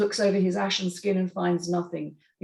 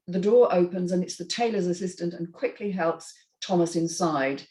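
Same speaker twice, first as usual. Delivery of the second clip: ordinary talk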